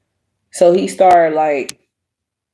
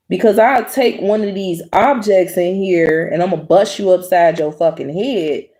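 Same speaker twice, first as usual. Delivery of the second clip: ordinary talk, nothing marked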